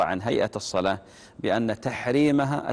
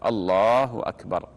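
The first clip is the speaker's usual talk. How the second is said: slow, full "الله أكبر"; demonstrated takbir; slower than usual. Shown as a demonstration. Imam demonstrating the takbir with raised hands. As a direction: call "الله أكبر" as if opening prayer. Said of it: "هكذا".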